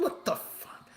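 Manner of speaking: unvoiced swearing under the breath; whispered disbelief. Real, not acted. Streamer who got nothing in the game, whispered disbelief.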